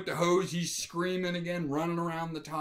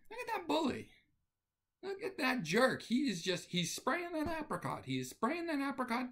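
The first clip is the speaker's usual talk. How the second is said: higher, softer tut-tutting onlooker voice; lightly voiced judgy parent muttering; mock disapproving bystanders; 11 semitones higher, 5 dB quieter than usual. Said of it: "looking up at me going".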